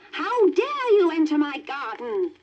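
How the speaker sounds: high, harsh witch cry; angry witch. Acted. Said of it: "cried the witch".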